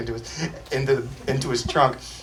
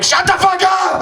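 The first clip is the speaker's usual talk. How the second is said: retold scream, much higher, louder and slow; imitated scream; 7 dB louder than usual. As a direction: scream it at your friend like he lost it. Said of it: "the only time he's ever screamed at me".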